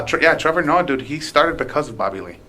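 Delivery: usual speaking voice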